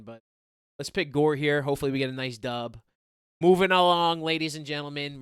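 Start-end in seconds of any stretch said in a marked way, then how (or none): 3.4-5.2 louder, higher ring-announcer call. Acted. Host announcing the bantamweight bout to viewers, announcer style. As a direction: open the next bout like an arena MC.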